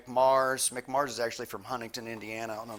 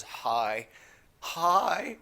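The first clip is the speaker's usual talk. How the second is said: tongue-tied; 6 semitones higher, 3 dB louder than usual. Retold or imitated. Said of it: "like, tongue-tied around a celebrity".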